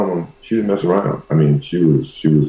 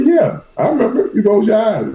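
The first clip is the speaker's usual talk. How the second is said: much higher, imitating his mother; high mama voice. Mother recalling the gun, high voice. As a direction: play the old mother remembering. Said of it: "I said".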